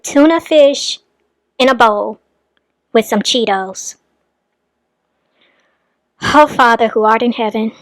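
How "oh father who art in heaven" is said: low, muted appeal to heaven; exasperated prayer. Real, not acted. Commentator appalled at tuna fish with Cheetos, exasperated prayer. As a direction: mutter a dismayed plea upward. Dismayed reaction to the tuna meal.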